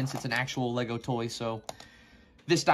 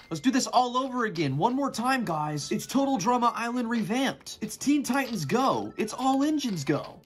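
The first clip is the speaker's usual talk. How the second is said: much higher and louder, a mock TV promo hype; hyped announcer; 9 semitones higher, 4 dB louder than usual. Builder announcing the repeat build to viewers, mock hype. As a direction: sell it like a cartoon trailer voice.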